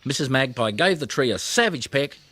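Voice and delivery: ordinary delivery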